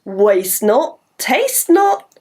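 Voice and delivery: usual speaking voice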